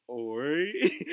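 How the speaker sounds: long, drawn-out questioning rise; wary reply. Acted. Creeped-out student answering the substitute's stare, warily. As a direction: let it climb slowly, unsure of him.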